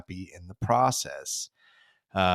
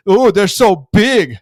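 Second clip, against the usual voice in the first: shrill, loud, slow mock terror; loud, high, sarcastic fake fear; mock-scared Burns; 9 semitones higher, 13 dB louder, slower than usual. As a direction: ham up being frightened to mock them. Burns keeps mocking.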